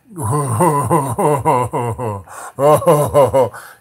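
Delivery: loud, long, wet villainous laugh; booming mock laugh of a grotesque matriarch; imitated laugh. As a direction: laugh like a huge gloating queen. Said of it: "she starts laughing".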